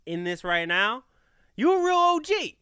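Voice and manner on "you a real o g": higher, louder hype; big up the real fans; hyping up loyal listeners; hype shout-out. Real, not acted.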